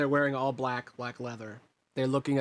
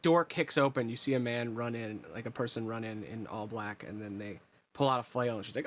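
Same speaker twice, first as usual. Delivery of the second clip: usual speaking voice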